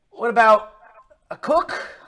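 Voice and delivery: high, loud questioning character voice; loud, rising traveller voice; hopeful stranger. Acted. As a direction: pitch it up, offering through a door. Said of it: "He says".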